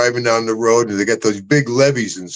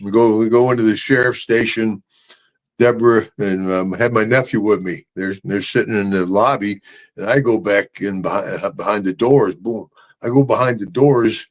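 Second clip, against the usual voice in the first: ordinary talk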